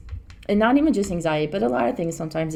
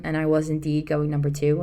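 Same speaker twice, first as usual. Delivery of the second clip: usual speaking voice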